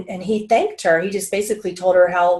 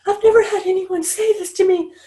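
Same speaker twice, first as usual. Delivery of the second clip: moved stylist; 12 semitones higher than usual. Retold or imitated. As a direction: lift way up, as someone overwhelmed. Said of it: "She was like".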